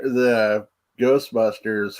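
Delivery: normal talking voice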